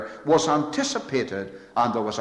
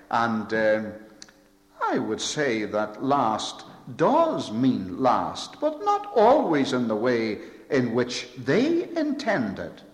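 ordinary talk, nothing marked

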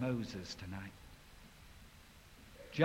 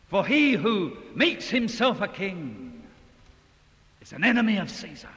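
high, much louder threatening cry; accusers' threat; 7 semitones higher, 9 dB louder than usual. Retold or imitated. Crowd threatening Pilate, loud. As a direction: hurl it at him as a threat. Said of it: "they trump up their last card".

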